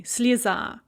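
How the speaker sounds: "sleza" with the ye said as ee, stress on final a; Russian "sleza", first vowel reduced to ee; unstressed ye as "e". Shown as a demonstration. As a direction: say "sleza" like slee-ZA. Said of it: "ye there is pronounced as an e".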